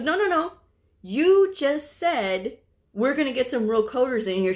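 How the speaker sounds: high cut-in, then emphatic quoting; insistent confrontation. Retold or imitated. Partner to the provider who denies it, insistent confrontation. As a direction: hold the person to their words.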